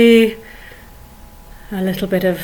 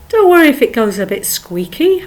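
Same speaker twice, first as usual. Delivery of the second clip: ordinary talk, nothing marked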